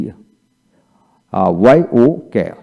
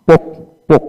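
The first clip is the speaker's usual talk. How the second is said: usual speaking voice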